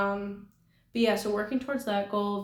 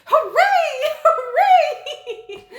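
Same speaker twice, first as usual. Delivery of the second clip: much higher and louder gleeful cheer, laughing; 11 dB louder, slower than usual. Retold or imitated. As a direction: cheer like a giddy gamer whose trick just worked. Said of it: "he's like".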